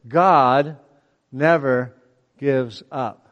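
"God ... never ... gives up" mit Pausen zwischen jedem Wort; slow, word by word. Shown as a demonstration. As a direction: jedes Wort einzeln setzen, langsam. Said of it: "let's change this word".

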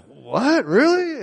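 high, rising shock at the news; can't believe your luck, voice jumping up; stunned disbelief. Retold or imitated. Hiker stunned that strangers are ER doctors, high disbelief.

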